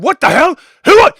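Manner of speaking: high, loud guard's challenge; alarmed shouting. Acted. Guards rushing in on the stabbing, shouting orders. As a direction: shout orders at armed strangers.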